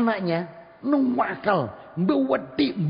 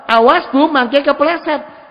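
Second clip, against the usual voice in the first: raised, high warning with a drawn-out end; loud but not angry; 7 semitones higher, 8 dB louder, slower than usual. Shown as a demonstration. Child warning a hard-of-hearing mother about slipping, loud but not angry. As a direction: call it out loudly without anger. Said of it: "Banter ndak apa-apa".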